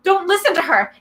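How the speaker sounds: high shouted warning. A narrator's reading. Hidden girl shouting warning to Percy. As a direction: call out from hiding to break the spell. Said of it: "Annabeth's voice shouted".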